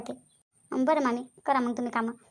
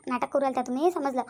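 ordinary talk, nothing marked

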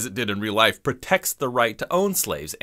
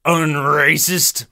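slow, louder hammed-up pompous retort; mock pompous yell; 4 dB louder, slower than usual. Forrest storming out of dinner with Lee, in a slow, hammed-up huffy yell. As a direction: drag out a theatrical huffy exit line. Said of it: "they yell at each other".

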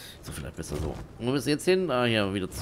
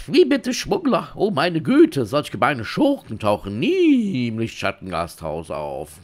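hammed-up innocence, loud outbursts and drawn-out words; indignant innkeeper; 3 semitones higher, 9 dB louder than usual. Innkeeper denying smugglers to a guest, theatrically scandalized.

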